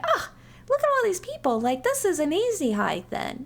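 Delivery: a quick, high squeal of mock delight; lightly hammed-up surprise at the crowd; mock excited. Hikers seeing the busy trail, mock excited. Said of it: "So we're like".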